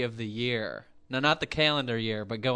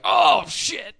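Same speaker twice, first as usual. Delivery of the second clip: high loud surprised curse; startled shout; 8 semitones higher, 6 dB louder than usual. Retold or imitated. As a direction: shout it in sudden shock. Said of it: "for you and me, it'd be like".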